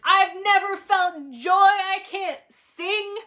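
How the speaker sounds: loud, high, hammed-up tantrum yell; mock tantrum. Imitating the heroine's tantrum when asked to sing. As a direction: wail it like a spoilt teen. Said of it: "She's like".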